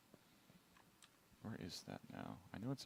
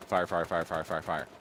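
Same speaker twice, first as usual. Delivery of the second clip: rapid spam fire; 2 semitones lower than usual. Shown as a demonstration. Developer demonstrates spam firing to avoid, rapid run-on "fire". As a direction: run each "fire" straight into the next. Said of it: "not fire".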